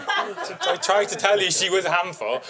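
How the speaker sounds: half-laughing I-warned-you; laughing told-you-so. Retold or imitated. Owner reminding the celebrity she warned him, laughing. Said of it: "I was like".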